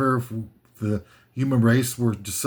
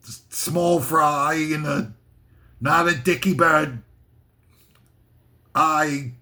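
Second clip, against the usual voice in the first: put-on Australian accent; mock Australian. Riffing on an odd phrase as an Aussie, mock Australian. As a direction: do a hammed-up Aussie bloke. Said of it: "Sounds like something Australian".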